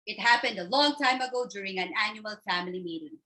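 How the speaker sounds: usual speaking voice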